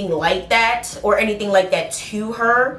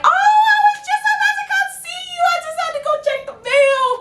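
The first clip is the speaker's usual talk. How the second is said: very high sing-song squeak; hammed-up high chirp covering being caught; fake-innocent excuse; faster than usual. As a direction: rush out a falsetto, sugary alibi. Caught sneaking away, fakes casual excuse.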